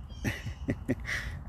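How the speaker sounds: soft, breathy giggle. Real, not acted. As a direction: let a quiet giggle slip out mid-thought. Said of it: "I just giggled like that".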